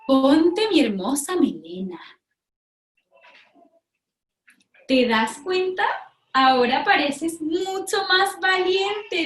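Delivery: slow, sing-song lion voice, lightly voiced; a hint of a boastful lion showing off his mane; proud lion. Lion offers the pig his mane, proud sing-song.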